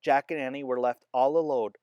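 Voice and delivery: usual speaking voice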